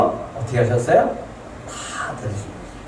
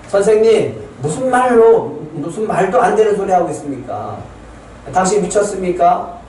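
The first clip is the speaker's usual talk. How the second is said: mocking disbelief; 3 semitones higher than usual. Mourners scoffing at Jesus, mocking disbelief. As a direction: scoff at him as if he's mad.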